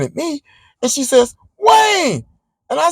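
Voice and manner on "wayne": high-pitched, delighted-to-recognize-you call; surprised call. Retold or imitated. Woman spots an old friend outside the store, surprised call.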